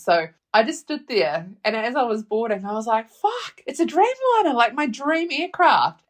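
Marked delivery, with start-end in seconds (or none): 3.1-6.0 much higher, giddy "Dreamliner"; excited squeal. Retold or imitated. Boarding her favourite aircraft: high, giddy excited squeal. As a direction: squeal it like seeing your dream plane. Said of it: "I was just a little bit excited".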